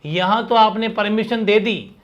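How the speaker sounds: ordinary talk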